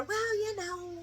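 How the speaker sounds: high, soft mock airy excuse; mock blithe woman, high and small; imitating her. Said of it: "this is her".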